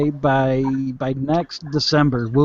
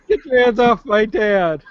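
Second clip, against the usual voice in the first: higher, hammed-up kid yelling at the attacker; mock protective kid; 7 semitones higher than usual. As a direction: play the kid defending his dad, half-laughing. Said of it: "You come running in, just start clubbing him".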